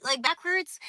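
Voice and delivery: talking gingerly with sore teeth; sore jaw from braces. Real, not acted. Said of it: "I've been not wanting to bite this".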